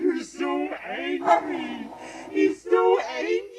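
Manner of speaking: high and loud, teasing the pup. Real, not acted. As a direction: coo it up at the grumpy dog. Teasing the grumpy dog.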